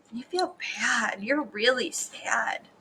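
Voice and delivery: soft, slow, downcast; hushed, sorrowful explanation; sad. Acted. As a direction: say it slowly with quiet sympathy. Explaining miserable to children.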